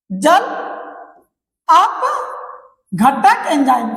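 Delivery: जल, long pause, then अपघटक enzyme very slowly; slow term breakdown. Shown as a demonstration. Teacher dictating the enzyme's name to students, slow and broken up. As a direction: break जल from अपघटक and stretch each part.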